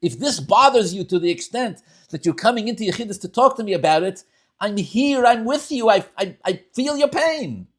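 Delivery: a Rebbe pouring out empathy to his chassid; empathetic Rebbe. Acted. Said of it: "He has to tell the chassid".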